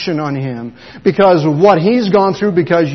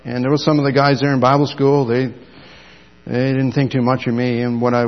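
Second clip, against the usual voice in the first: ordinary talk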